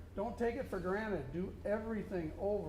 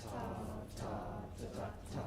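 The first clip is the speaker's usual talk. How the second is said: whispered ta; 10 semitones lower, 7 dB quieter, much faster than usual. Shown as a demonstration. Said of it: "Ready, say".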